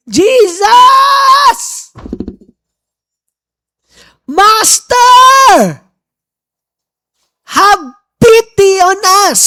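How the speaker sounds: high, drawn-out desperate shout; loud cry for help. Acted. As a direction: call to someone far off, begging for mercy. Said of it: "Ang kanilang sinisigaw".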